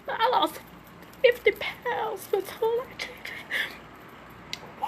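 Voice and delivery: snotty crying. Real, not acted. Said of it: "I got an ugly cry".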